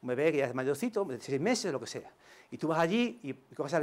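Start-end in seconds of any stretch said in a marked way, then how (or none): none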